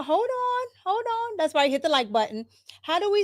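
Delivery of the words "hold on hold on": much higher, startled hold on; sudden surprise. Real, not acted. Host startled by a chat comment, high surprise. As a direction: caught off guard by the chat.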